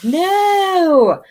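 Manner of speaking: long, high wail of disbelief; dismayed cry. Retold or imitated. Mother retelling her son's sad phone call, dismayed cry. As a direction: re-enact a drawn-out cry of protest. Retold reaction to death news.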